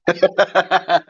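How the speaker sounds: high, fast, giggling apology; laughing high mock apology. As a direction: squeak it out through laughter.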